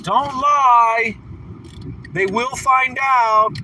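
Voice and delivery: high, slow mocking warning; imitating a preachy viewer's warning; mock Sunshine. Mocking a viewer who warns against lying, high and slow. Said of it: "She's like".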